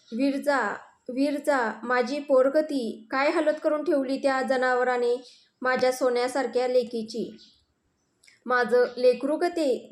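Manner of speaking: ordinary delivery, nothing marked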